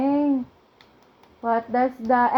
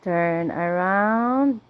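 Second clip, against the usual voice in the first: slow sing-song prompt; 6 semitones lower, slower than usual. Acted. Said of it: "parent prompts toddler slowly".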